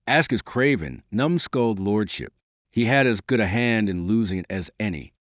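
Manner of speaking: ordinary delivery, nothing marked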